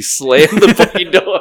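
words broken by a laugh; laughing through it. Real, not acted. Telling how the drunk friend slammed the door, laughing. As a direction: laugh mid-punchline.

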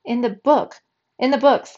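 high, loud, rushed correction; frantic. A narrator's reading. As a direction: blurt it out in a panic. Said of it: "She's frantic".

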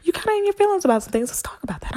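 high, soft coaxing voice; gentle God voice. Retold or imitated.

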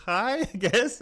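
mock unimpressed girl. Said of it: "she was just like".

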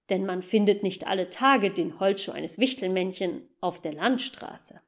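usual speaking voice

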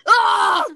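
loud high yelped sneeze; imitating a shrill sneeze. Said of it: "heard you sneeze like this".

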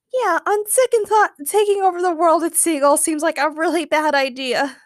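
high-pitched sheepish lady; sheepish villainess retreating. A narrator's reading. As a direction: back down meekly in a high female voice. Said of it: "Sally said sheepishly".

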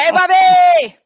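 loud high greeting shout to Bobby; calling out. Real, not acted.

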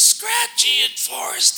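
strained, scratchy, choked voice, high and loud; imitating a pastor's ruined voice; damaged voice imitation. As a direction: force the words out like your vocal cords are shot. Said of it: "That's what he sounded like".

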